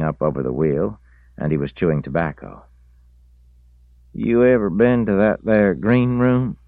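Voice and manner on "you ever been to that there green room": high, loud country drawl; rural drawl. A narrator's reading. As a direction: drawl it like an old feller. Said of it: "said Reese".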